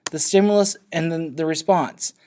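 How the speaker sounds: usual speaking voice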